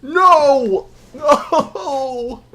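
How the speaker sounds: high cry breaking into a laugh; despairing shout. Real, not acted. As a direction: wail it, then laugh. Dies to the boss.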